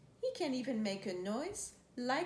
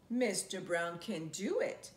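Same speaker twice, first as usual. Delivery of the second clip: usual speaking voice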